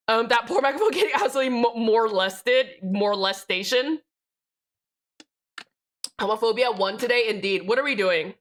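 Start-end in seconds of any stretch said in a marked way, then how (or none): none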